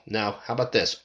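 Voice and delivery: usual speaking voice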